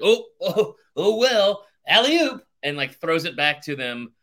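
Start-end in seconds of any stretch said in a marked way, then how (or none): 0.0-2.4 high, loud goofy "alley-oop"; hammed-up cheerful bystander, pitched up and loud; passerby voice. Podcaster imitating a bystander tossing the puck back, high and loud. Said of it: "And he's like".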